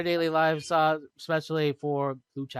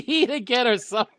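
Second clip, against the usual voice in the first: laughing; 9 semitones higher, 7 dB louder than usual. Real, not acted. Laughing while quoting.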